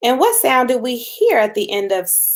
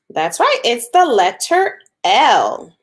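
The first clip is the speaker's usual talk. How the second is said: normal talking voice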